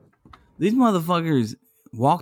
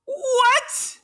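very high-pitched, drawn-out "what"; odd-sounding yelp. Real, not acted. Co-host reacts to the five-minute claim, odd high yelp. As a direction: squeak it out in disbelief. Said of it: "why does he sound like that".

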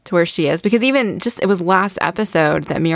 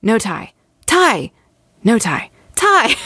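excited nerdy replay; 5 semitones higher, slower than usual. Retold or imitated. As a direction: alternate flat "No tie" with squealed "Tie". Excitedly replaying tie frames.

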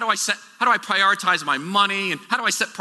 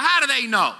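bewildered question; 9 semitones higher than usual. Retold or imitated. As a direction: play the baffled doubter. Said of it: "I'm like".

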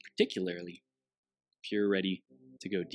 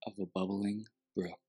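guided meditation. Acted.

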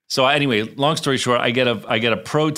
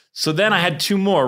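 ordinary talk, nothing marked

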